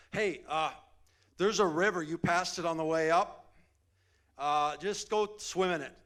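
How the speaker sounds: loud, high calling out to Naaman; loud servant call. Retold or imitated. Servant loudly calling to Naaman with instructions. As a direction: servant shouting directions across to him. Servant voiced in retold story.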